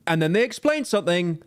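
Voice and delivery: mocking news-report delivery; mock newsreader. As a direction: parody newsreader cadence, starting high and settling at the end. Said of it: "they end the sentence like this".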